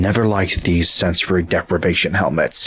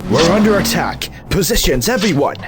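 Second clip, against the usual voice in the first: rally everyone as the attack hits; urgent battle call; 10 semitones higher than usual. Acted. Leader warns the team of an ambush, urgent shout.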